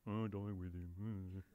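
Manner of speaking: resigned mournful drone; gloomy Eeyore. Acted. Preacher voicing doubting Thomas, deep and mournful.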